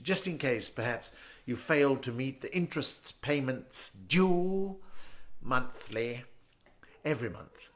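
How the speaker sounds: very slow, stiff posh British; posh British bank manager. Acted. Bank manager doubting a mortgage applicant, posh British condescension. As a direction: draw the words out in snooty British, fairly soft. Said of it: "Then he goes like".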